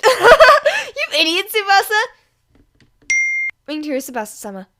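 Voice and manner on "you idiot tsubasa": high, loud, slow laughing insult; laughing jeer. A narrator's reading. Friend laughing at Tsubasa's email mistake, loud. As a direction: laugh at his blunder.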